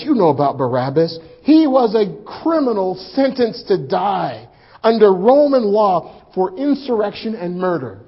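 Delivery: ordinary talk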